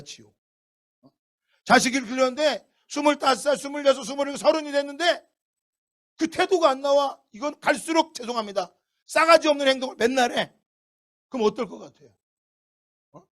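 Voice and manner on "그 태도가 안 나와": ordinary delivery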